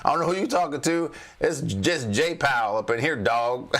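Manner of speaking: swaggering mock Powell; street-tough Powell. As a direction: talk back like a tough guy from the streets. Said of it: "And Powell's like".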